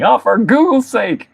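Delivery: high, drawn-out comic exclamation; mock exclamation. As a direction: play up the silly oath, pitched high and slow. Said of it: "I love saying, saying".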